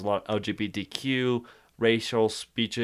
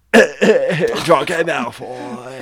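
loud, hugely drawn-out British name; hammed-up British accent, slow and loud; Draco Malfoy; 4 semitones higher, 11 dB louder, much slower than usual. Shown as a demonstration. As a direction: show off the name, dragging it out. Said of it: "I was like".

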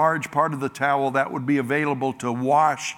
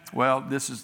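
ordinary talk, nothing marked

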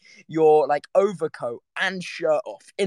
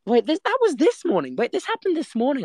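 higher, startled double take; sudden realisation; 10 semitones higher than usual. Real, not acted. Telling viewers the story, suddenly realising it was this morning, surprised. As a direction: catch yourself mid-story, surprised.

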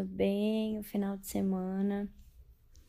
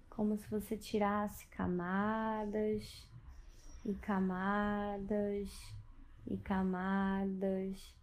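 guided meditation; 5 dB quieter than usual. Acted. Leads a bedtime meditation.

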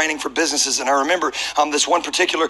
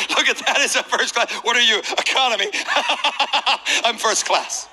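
snooty gloat; 6 semitones higher than usual. Retold or imitated. Gloating at economy passengers.

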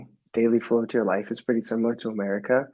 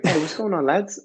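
mock British accent; 6 semitones higher than usual. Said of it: "speaking like you're from England".

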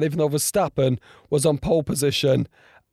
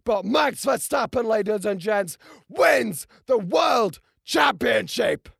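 high, raised announcer calling the win; excited commentary; 11 semitones higher than usual. Real, not acted. Vlogger announcing Verstappen's title to viewers, elated. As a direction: announce the champion to the crowd.

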